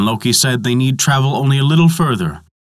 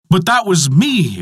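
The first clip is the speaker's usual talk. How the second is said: higher, startled outburst; exclaiming; 6 semitones higher than usual. A narrator's reading. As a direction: blurt it out in surprise. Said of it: "exclaimed Thor".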